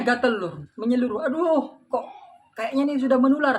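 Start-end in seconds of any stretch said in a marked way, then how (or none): 1.3-3.5 higher and rushed, alarmed "aduh"; an official panicking as his itch spreads; panicked cry. Retold or imitated. Official panics over itching.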